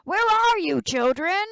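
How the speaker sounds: loud, high impatient shout; wolf waiting below, shouting up at the missing children; wolf's shout. Acted. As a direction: yell for the children to come back. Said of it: "the wolf shouted".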